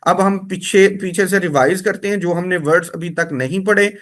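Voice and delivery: usual speaking voice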